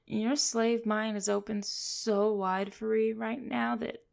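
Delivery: ordinary talk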